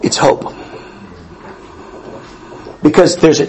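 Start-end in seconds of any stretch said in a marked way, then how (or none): 0.0-0.5 breathy whisper. Acted. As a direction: whisper the word hope. Whispered echo of Leia.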